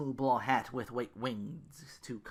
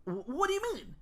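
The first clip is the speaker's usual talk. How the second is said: much higher stammered shock. Acted. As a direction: stammer in disbelief. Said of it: "Ru looked shocked".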